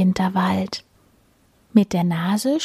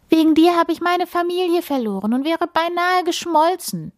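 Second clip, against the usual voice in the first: higher, a mild scold from a small snowflake; 11 semitones higher than usual. A narrator's reading. Snowflake blames the wind for losing her family, mildly scolding. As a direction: tell off the wind, half-hearted. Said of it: "schimpft die kleine Flocke".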